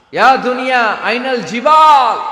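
slow, loud and high, the Arabic stretched out; booming call. Acted. Preacher voicing God calling the earth, booming slow shout. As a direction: thunder the question across the hall.

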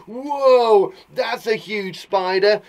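long, high, loud "whoa", then drawn-out alarm; mock scared. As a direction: play startled by a spider, hammed up. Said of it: "being scared by a spider".

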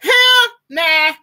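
imitating grandma's high challenge. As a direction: channel your grandma asking. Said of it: "that's how my grandma talks".

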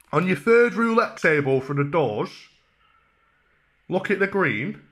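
ordinary talk, nothing marked

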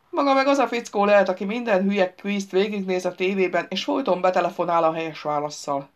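ordinary delivery

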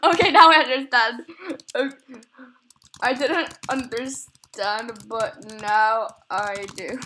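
loud exclamation, then slowing right down; loud realization. Real, not acted. Player declaring they finally understand, loud and emphatic. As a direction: belt it out, stretching the later words.

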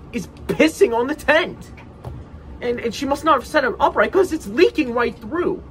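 worked-up storyteller, loud and high; agitated outrage. Acted. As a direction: let disgusted disbelief push the voice up. Outraged retelling of tent leak.

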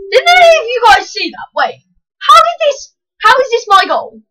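high, loud incredulous shout; can't believe what just happened; baffled. Real, not acted. Asking viewers if they saw the fluke goal, high, loud disbelief.

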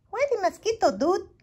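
very high, squeaky little voice; tiny dog voice. A narrator's reading. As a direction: pipe up like the smallest pup. Smallest Chihuahua speaks up.

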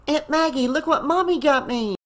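put-on high child voice, calling out eagerly; young boy showing off his new toy; excited boy calling. A narrator's reading.

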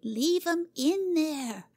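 put-on slow, high child's snap; passionate retort. Cora scolding Jimmy: mild passionate girl retort. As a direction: put him in his place. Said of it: "retorted the girl passionately".

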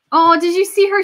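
high, loud burst of emotion; high-pitched, touched outcry; excited gasp. Real, not acted. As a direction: blurt it out, overwhelmed. Moved by the queen's tears.

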